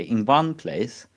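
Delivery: ordinary delivery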